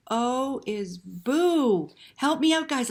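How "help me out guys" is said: ordinary delivery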